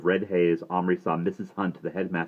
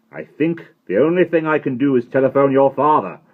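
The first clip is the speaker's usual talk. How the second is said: stern headmaster threat; 9 semitones higher, 12 dB louder than usual. A narrator's reading. Headmaster threatening to call father.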